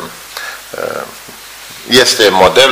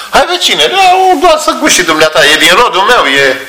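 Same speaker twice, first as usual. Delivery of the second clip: much higher, wheedling voice pushing a drink; coaxing neighbour; 9 semitones higher than usual. Retold or imitated. Neighbour urging the visiting believer to taste his brandy, higher and wheedling. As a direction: coax your guest to taste your homemade brandy.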